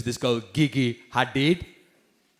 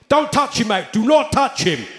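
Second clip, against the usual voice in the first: loud, higher barked warning; security guard warning; 8 semitones higher, 4 dB louder than usual. Retold or imitated. Security guard to the comedian, loud warning. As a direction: bark it like a bouncer pushing you back. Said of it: "two of his security guards came up".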